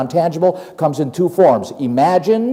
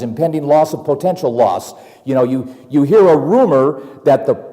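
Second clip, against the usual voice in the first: ordinary delivery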